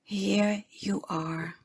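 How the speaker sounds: slow, hushed meditation voice; calm, unhurried guided meditation. Acted. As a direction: ease them gently into the contemplation.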